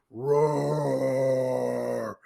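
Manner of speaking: long, drawn-out mock roar; imitated dragon's roar held on one long note. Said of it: "the roar is so loud".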